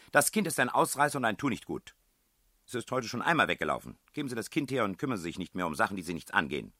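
high, loud, rapid tirade; angry scolding. A narrator's reading. Headmistress orders strangers to mind their business, fast angry scolding. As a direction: rattle off an indignant dismissal.

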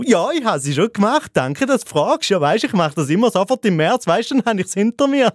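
high, gushing mock self-satisfaction; hammed-up smug show-off ending in a laugh; acted eager bragging. Smug asker blurts out his answer.

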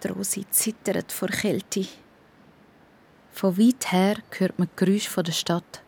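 usual speaking voice

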